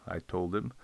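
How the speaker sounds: ordinary delivery, nothing marked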